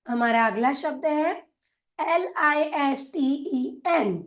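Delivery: ordinary talk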